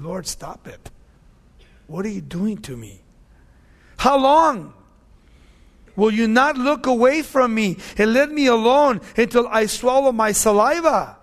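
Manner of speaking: preacher's loud lament; anguished scripture cry. Acted. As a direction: burst out pleading to God. Voices Job crying to God.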